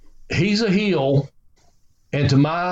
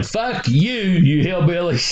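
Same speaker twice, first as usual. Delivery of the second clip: mock cursing; 3 semitones higher, slower than usual. Wrestler translating his polite blessing for Bobby, mock cursing. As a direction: throw it out as a teasing curse, pitch up. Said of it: "God bless him, Bobby, meaning".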